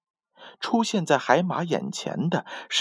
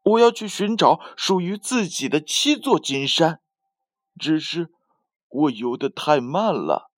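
proud; 5 semitones higher than usual. A narrator's reading. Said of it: "海马骄傲地说".

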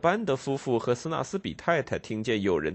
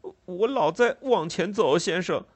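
put-on higher, pleading child's stammer; tearful boy; 7 semitones higher than usual. Ragged boy pleading with the constable, tearful and higher. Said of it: "一边哭着说".

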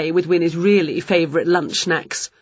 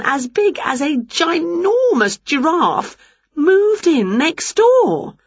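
indignant Winnie; 7 semitones higher than usual. Acted. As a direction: complain to your cat in high, exaggerated dismay.

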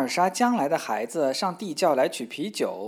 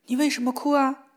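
higher, louder, puzzled maid's voice; a young maid asking in surprise; startled maid puzzled by the crying; 9 semitones higher, 5 dB louder than usual. Acted. Maid asks crying Elsa.

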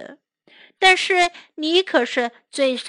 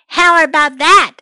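bragging; 3 semitones higher, 8 dB louder than usual. Acted. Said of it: "said Tiger".

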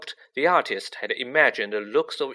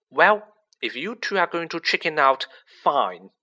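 stung anger; 5 semitones higher, 5 dB louder than usual. A narrator's reading. Hermione stung, cheeks flushed.

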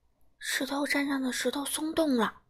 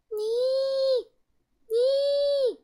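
much higher, loud desperate calling; calling desperately; 13 semitones higher, 4 dB louder than usual. Acted. Baby dinosaurs calling desperately for their lost brother, loud. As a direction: call out with all their strength. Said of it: "四只甲龙宝宝也拼命地呼喊".

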